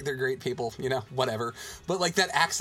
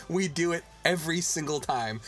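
ordinary talk

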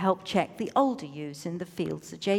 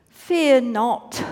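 pleading; 3 semitones higher, 8 dB louder than usual. A narrator's reading. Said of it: "a note of pleading in it".

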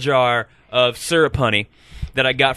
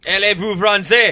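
French impression; 4 semitones higher than usual. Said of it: "That was my impression of French".

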